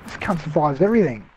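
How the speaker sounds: ordinary talk, nothing marked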